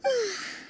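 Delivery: a long weary sigh out; exhausted sigh. Acted. Leader alone after the crowd, tired sigh. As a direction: breathe out the stress of the crowd.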